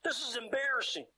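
high, pleading retold prayer, voice lifted; tearful plea. Preacher retelling his tearful plea to God, high and pleading.

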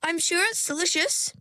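put-on young mergirl refusing, firm but courteous. A narrator's reading. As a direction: hold your ground politely. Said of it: "said Ringlet in a firm voice".

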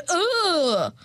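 exasperated ugh; annoyed groan. Real, not acted. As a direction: groan at the alarm. Annoyed at alarm.

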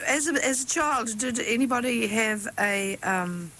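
ordinary delivery

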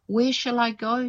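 a high, mildly tearful question; lightly voiced despairing girl; sobbing. A narrator's reading. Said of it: "she sobbed".

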